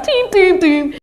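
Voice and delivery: high, ringing mimicked pops; loud, high sound-effect syllables; imitated popping noise. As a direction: mimic kids popping things, high and punchy. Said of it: "And they're like".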